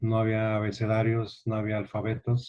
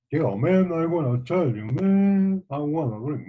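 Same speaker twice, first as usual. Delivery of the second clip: mock street English, higher and punchy; hammed-up "yo, man" delivery; 5 semitones higher, 3 dB louder than usual. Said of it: "¿cómo hablan?".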